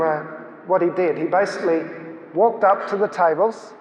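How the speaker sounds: ordinary delivery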